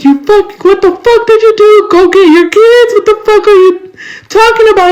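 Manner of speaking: imitating mom's scolding, pitched high; mom going off. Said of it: "my mom goes off. She doesn't just fucking talk to me nicely with love".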